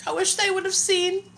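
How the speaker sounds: real tears welling up as she speaks; emotional, near tears. Real, not acted. Mother on livestream wishing her parents had helped, tearful. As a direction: voice rising high and soft, close to crying. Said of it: "I'm emotional".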